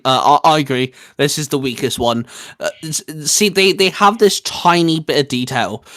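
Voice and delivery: usual speaking voice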